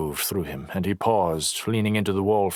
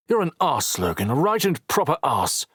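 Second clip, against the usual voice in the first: snapping; 7 semitones higher than usual. A narrator's reading. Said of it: "Knox snapped".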